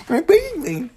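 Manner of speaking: high cooing to the dog. Real, not acted. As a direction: coo it like to a puppy. Baby talk to dog.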